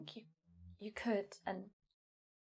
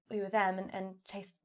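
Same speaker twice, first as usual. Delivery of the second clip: ordinary delivery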